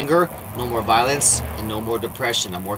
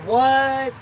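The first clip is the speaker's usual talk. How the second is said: startled what; 12 semitones higher, 4 dB louder than usual. Real, not acted. Sudden startled question.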